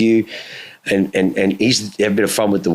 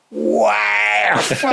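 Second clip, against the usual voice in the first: put-on high, cracking cry of a thrilled kid; a kid's high, excited whoop in a sports car; retold childhood thrill; 12 semitones higher than usual. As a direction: shout out the wonder of the ride. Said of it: "I'm like".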